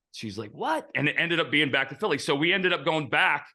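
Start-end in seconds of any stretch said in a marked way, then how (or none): 0.5-0.9 sharp high rising "What?"; imitating girlfriend's shock; surprised what. Girlfriend hears about the trade.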